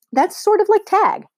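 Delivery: usual speaking voice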